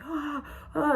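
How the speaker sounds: high mock yelps of pain; imitated pained ah ah; pained cries, imitated. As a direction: yelp like it hurts. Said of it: "that hurt him".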